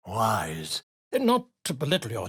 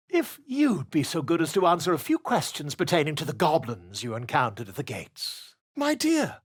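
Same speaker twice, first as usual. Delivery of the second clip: ordinary talk, nothing marked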